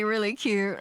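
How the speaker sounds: usual speaking voice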